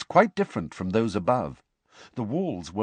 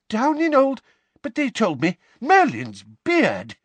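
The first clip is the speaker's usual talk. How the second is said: shocked; 13 semitones higher, 5 dB louder than usual. A narrator's reading. Mister Weasley hears the hearing moved and blurts in shock. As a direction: caught off guard, the oath dragged out.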